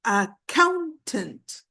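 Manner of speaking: "accountant" slowly, a-COUN-tant; stress on second syllable. Shown as a demonstration. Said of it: "The stress is on the second syllable".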